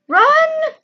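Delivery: high shrill yelled command; yelling. Real, not acted. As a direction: scream at the character to run. Character can't run.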